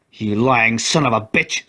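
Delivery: slow, biting insult hurled at McGrath; snapping insult. A narrator's reading. Lloyd insulting McGrath to his face, snapping. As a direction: spit out the accusation. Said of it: "snapped Lloyd".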